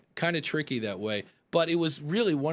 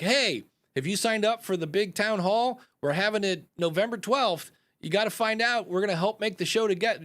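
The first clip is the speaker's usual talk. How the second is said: mock announcer promo, higher pitch; promo read; 6 semitones higher, 3 dB louder than usual. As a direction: hype the town hall like a sponsor spot. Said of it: "And it could be like".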